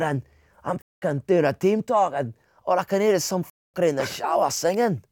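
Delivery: exasperated mock-Scottish team talk; Scottish accent. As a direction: rant like a Scottish manager, put-on accent. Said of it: "That's a good accent".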